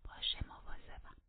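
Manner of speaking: whispered reply. Acted. Catherine answers the narrator's warning, whispered. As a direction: breathe the answer without voice. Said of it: "کاترین گفت".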